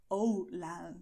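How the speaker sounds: "hola" as "oh-lah" with a gliding English O; strong English accent. Shown as a demonstration. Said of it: "a strong English accent would say".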